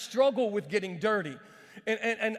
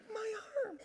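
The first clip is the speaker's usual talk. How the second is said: high, soft pained whimper; comic falsetto whine of pain; mock hurt lady; 13 semitones higher, 9 dB quieter than usual. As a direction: imitate her in a tiny wounded voice. Imitating the lady he chopped.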